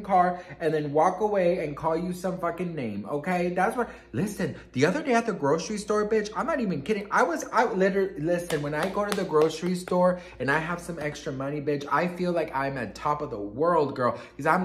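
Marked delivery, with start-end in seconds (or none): none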